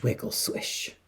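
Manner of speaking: ordinary delivery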